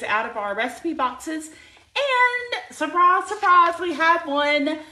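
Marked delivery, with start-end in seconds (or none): 1.9-3.3 high, slow, drawn-out and surprise; playful surprise. Real, not acted. To live viewers, announcing a new menu, playful sing-song. 3.3-4.8 loud high echo of surprise; playful surprise. Real, not acted. To live viewers, revealing the April plan, loud and playful.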